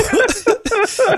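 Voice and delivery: high burst of laughter; real laughter. Real, not acted. Host laughing hard at the guest's story.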